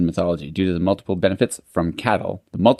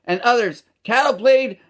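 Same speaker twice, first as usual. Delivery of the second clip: very high-pitched Canadian trailer-park voice; Canadian pikey accent; 14 semitones higher than usual. Acted. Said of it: "article in that accent".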